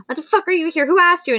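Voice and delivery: indignant mock outrage. [name] snaps at Liesel for barging in, in high mock outrage. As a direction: pretend to be outraged at the intruder. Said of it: "I was like".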